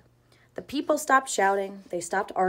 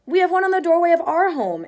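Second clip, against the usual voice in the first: high, loud, thrilled exclaim; girl spotting the mezuzah, loud and thrilled; excited exclaim; 8 semitones higher, 7 dB louder than usual. A narrator's reading. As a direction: burst out with delighted recognition. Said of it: "Annie exclaimed".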